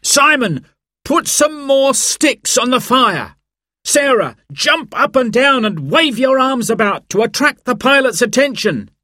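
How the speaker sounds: high-pitched urgent commands; shouted orders. A narrator's reading. Dad directs the children to signal the pilot, shouting. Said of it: "shouted Dad".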